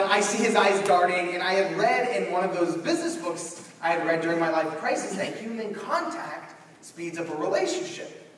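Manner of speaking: ordinary delivery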